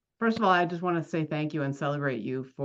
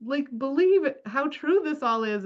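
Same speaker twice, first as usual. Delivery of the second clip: amazed gush; 9 semitones higher than usual. Retold or imitated. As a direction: gush like a stunned fan. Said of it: "they were just like".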